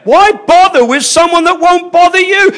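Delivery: a passionate preacher rising to a peak; fervent preaching. Real, not acted. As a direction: preach it at full force. Preaching at full force.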